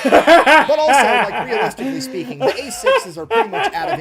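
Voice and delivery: talking through big laughs; can barely get the words out laughing. Real, not acted. Narrator cracking up mid-story.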